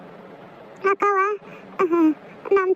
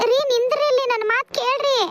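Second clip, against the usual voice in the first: higher-pitched, anxious plea; urgent pleading; 5 semitones higher than usual. Acted. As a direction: beg him to stop and listen. Wife stops husband walking off. (ಆತಂಕದಿಂದ ಬೇಡಿಕೊಳ್ಳುತ್ತಾ)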